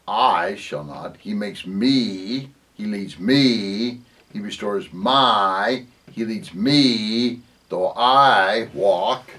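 "I", "me" and "my" drawn out slowly; slow phrases, each leaning long on "I", "me" or "my"; stressed personal pronouns. Shown as a demonstration. Preacher pointing out singular pronouns, slow stress on "I", "me", "my". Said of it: "all the pronouns are singular and they're personal".

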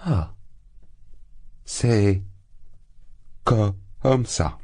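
a thrown, disbelieving outcry; stunned exclamation. A narrator's reading. Rejected suitor cries out, caught completely off guard. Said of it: "s'écria Lougine".

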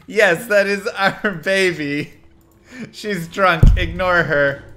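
emphatic correction. Retold or imitated. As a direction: overrule your partner for the stranger's benefit. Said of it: "I was like".

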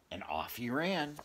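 plain reading voice

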